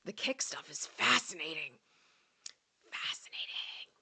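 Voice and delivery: slow, hushed playful whisper; mock ASMR whisper. Commenting on the Kick stuff to viewers, mock ASMR whisper.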